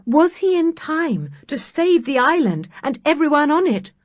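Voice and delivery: ordinary delivery, nothing marked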